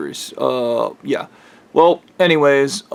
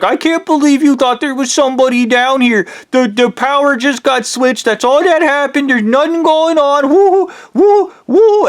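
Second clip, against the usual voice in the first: mocking friend; 10 semitones higher than usual. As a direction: gloat in a high sing-song sneer. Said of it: "Taco's like".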